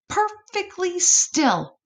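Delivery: ordinary delivery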